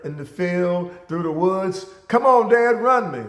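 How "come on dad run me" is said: high, loud challenge to dad; teenage son daring his father to race, loud and high; teenage taunt. Retold or imitated. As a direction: call out the dare, pitched up and loud. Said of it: "I said".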